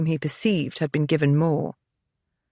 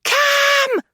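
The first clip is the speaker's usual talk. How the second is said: much higher, louder shriek of a name; 4 dB louder than usual. A narrator's reading. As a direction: shriek it with all her breath. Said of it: "Evie shrieked again".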